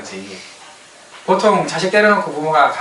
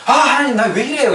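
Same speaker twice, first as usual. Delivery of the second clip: high-pitched exclaimed protest; mock complaint to God; 10 semitones higher, 4 dB louder than usual. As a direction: cry out in hammed-up grievance. Voices a complaint to God to reject. (하나님께 높게 따지는 척)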